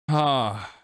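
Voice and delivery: normal talking voice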